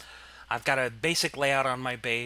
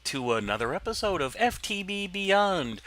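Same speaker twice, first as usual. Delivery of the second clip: higher, louder show-host intro; big punchy episode opener, pitched up; recording voice; 8 semitones higher, 7 dB louder than usual. Real, not acted. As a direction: greet the audience in a loud, put-on host voice. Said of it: "you have a different voice when you're recording".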